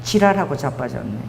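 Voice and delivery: "지랄하고 자빠졌네" dropped low and gruff in Busan dialect, falling flat at the end; blunt Busan retort. Pretend. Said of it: "제가 부산 사람이거든. 딱 이렇게 얘기했어요".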